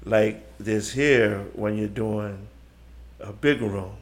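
slow, spaced-out words with pauses; slow right down so the back row catches it; demonstrating slow big-room stage delivery. Shown as a demonstration. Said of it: "learn how to speak like this".

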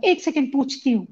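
put-on high girlish hello; man doing a woman's voice. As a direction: answer like a young woman. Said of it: "And she said".

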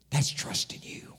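hushed, breathy-free whisper to God; whispered prayer. Acted. Pastor whispering his trust to God.

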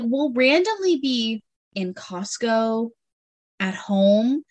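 ordinary delivery

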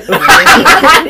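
high, loud burst of laughter. Real, not acted. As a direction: crack up out loud. Tertawa di tengah saling ledek. (tawa keras bernada tinggi)

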